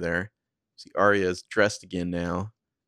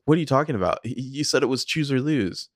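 acted higher-pitched, agitated objection; alarmed protest; 6 semitones higher than usual. [name] to the voice on the phone, mock alarmed protest. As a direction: protest as if the rules just changed.

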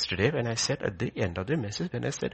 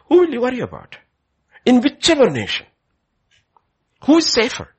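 high, loud challenge to the crowd; loud preaching questions; 11 semitones higher, 11 dB louder than usual. Real, not acted. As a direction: fire the questions at the congregation. Preacher calls questions to congregation.

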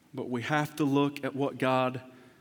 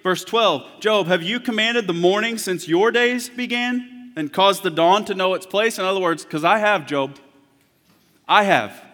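high, loud sermon delivery; preaching at full force; 4 semitones higher, 7 dB louder than usual. Acted. Preacher voicing God's challenge to Job, loud and high. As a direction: thunder God's questions at Job.

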